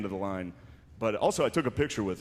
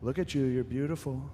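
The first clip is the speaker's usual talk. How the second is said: a hint of Trump flattering a stranger; Donald Trump impression; 3 semitones higher than usual. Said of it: "and he was like".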